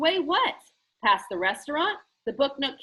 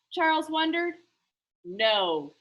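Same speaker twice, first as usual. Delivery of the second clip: ordinary delivery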